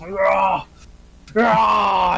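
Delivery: two loud, high roars; acted berserk screams; battle yell. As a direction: roar with the effort of ripping. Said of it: "he starts pulling his arms off".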